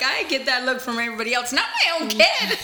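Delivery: laughing mock dismay at her own kid; laughing dismay at her toddler's stare. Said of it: "'cause I'm like".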